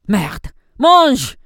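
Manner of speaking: high, furious yell; explosive angry obscenities; shouted French curses. Acted.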